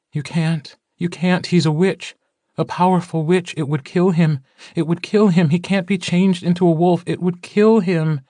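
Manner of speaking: high-pitched, insistent female character voice; urgent objection. A narrator's reading. Elizabeth objects urgently in dialogue.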